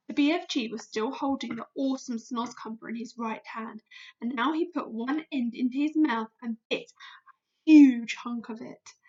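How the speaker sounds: plain reading voice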